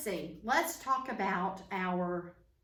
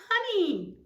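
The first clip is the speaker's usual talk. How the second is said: high, loud cry of the answer; playful, high and loud reveal; excited reveal; 9 semitones higher, 9 dB louder than usual. Pretend. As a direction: burst out with the answer for children. Reveals the answer to small children.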